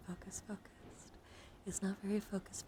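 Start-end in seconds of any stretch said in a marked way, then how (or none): 0.1-1.1 slow, softer whispered repetition; hushed ASMR trigger word; whispered echo. Real, not acted.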